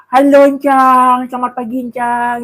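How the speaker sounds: high, drawn-out mock greeting; imitated greeting. Imitating how they greet Encang, high sing-out call. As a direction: call it out in a stretched, high put-on voice. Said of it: "kalau ngomong Encang kan gini".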